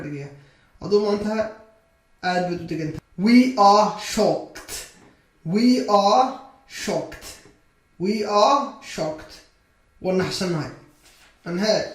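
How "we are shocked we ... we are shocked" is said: slow, drawn-out model sentence; slow modelling. Shown as a demonstration. Teacher models a sentence for English learners, slowly. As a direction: stretch the words for learners to copy.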